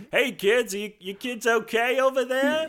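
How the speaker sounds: a high, cheery call from a rowboat; well-meaning neighbor calling across the water; high friendly boater voice. Retold or imitated. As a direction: sing out to the kids like a kindly neighbor. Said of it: "the guy in the little rowboat who's like".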